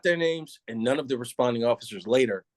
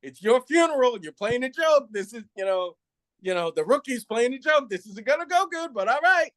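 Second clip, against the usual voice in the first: high, weary "it's your funeral" sarcasm; sceptical resigned tone; 9 semitones higher than usual. Retold or imitated. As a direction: humour the rookie he thinks is joking. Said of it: "he's got that tone like".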